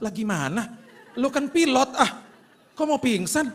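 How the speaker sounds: high, loud disbelieving laugh-shout; incredulous shout. Retold or imitated. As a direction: react to a pilot about to faint. Businessman shocked at the pilot.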